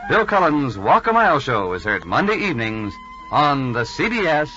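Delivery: ordinary talk, nothing marked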